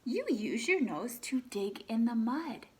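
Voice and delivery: lively read-aloud, animal names lifted; reading to children. Acted. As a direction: read the book to the class, naming each animal brightly. Teacher reads picture book aloud.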